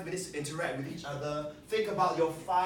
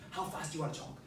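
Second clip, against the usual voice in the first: ordinary talk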